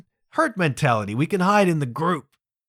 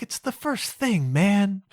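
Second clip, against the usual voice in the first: whiny protest; 6 semitones higher, slower than usual. Retold or imitated. A failing adult student whines to the instructor. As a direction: complain like a defeated kid.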